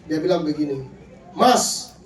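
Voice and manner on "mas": louder, higher wife's yell; raised-voice shout. Retold or imitated. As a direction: call out sharply to a husband from another room. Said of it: "dari dalam kamar itu berteriak".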